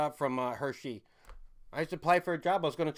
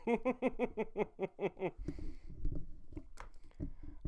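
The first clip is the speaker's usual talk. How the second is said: breathy silent laugh, higher; long wheezing laugh; 9 semitones higher, 4 dB quieter than usual. Real, not acted. Streamer laughs at his sabotage joke. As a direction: let a long airy laugh run out.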